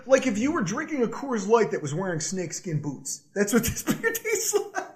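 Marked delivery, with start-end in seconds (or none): 3.4-4.9 laughing through it. Real, not acted. Laughing at own joke.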